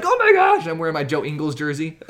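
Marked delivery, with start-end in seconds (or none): 0.0-0.7 excited squeal. Retold or imitated. Fan popping out excited.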